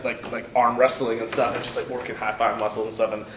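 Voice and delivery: usual speaking voice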